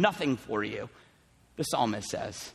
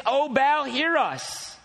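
raised, pleading cry to an idol; calling out to Baal; 6 semitones higher, much slower than usual. A narrator's reading. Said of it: "They're calling out to their God".

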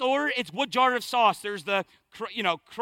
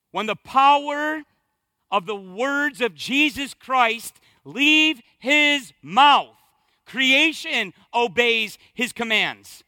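raised, ringing pulpit proclamation; impassioned sermon delivery; full-force preaching; 3 semitones higher, 4 dB louder than usual. Real, not acted. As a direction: proclaim it with emphatic, punched phrases.

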